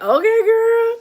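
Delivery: high, loud, drawn-out squeal; playful mock astonishment. Aunt reacting to her sassy little niece, playfully astonished. Amused reply to sassy four-year-old niece.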